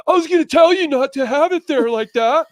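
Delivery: mocking high-pitched scolding; loud, squealed after-the-fact nagging, hammed up; Brandon. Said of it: "and Brandon was like".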